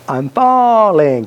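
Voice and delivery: hammed-up "I'm falling"; mock falling cry. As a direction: stretch out FALLING as if dropping. Acting out imagined fall.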